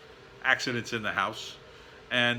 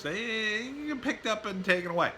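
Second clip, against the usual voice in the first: high voice; 10 semitones higher, 4 dB quieter than usual. Acted. Warning about hawks taking dogs.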